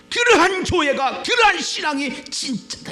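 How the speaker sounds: high, loud, forceful push; emphatic preaching. Real, not acted. Preacher drives home the point.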